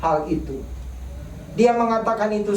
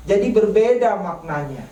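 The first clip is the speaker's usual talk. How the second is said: ordinary delivery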